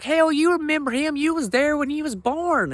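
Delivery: high, slow mother's voice; mom's voice. Retold or imitated. Comic voicing his mom scolding him about cousin Billy, high and slow. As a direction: play his Southern mom, high and drawn-out. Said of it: "She was like".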